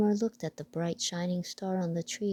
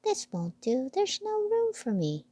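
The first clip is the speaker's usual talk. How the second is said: high, fussy mouse voice; a put-out little mouse; piping complaint; squeaky mouse voice; 7 semitones higher than usual. Acted.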